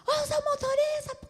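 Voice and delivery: loud, high yell at a driver; mock officer's shout. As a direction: play an officer shouting someone down. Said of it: "Ele chega lá gritando". (grito de guarda bravo, alto e agudo)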